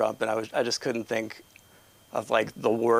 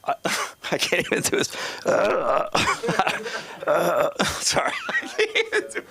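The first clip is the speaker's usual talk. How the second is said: real helpless laughter, voice pitched up; breaking up laughing; 6 semitones higher than usual. Real, not acted. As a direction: try to apologise through the giggles. Breaks up trying a joke.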